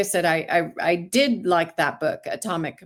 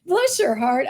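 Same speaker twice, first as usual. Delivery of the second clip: Southern bless your heart; 5 semitones higher than usual. Retold or imitated. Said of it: "I learned the meaning of bless your heart".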